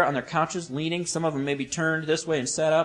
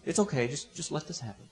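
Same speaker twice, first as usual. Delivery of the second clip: gentle answer; 6 dB quieter than usual. Acted. Jesus reassures objecting Peter, gentle. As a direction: soothe him, easing off to very quiet. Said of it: "this is a very gentle answer".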